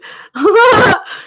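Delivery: loud, high burst of laughing; burst of laughter. Real, not acted. Real burst at chat flooding.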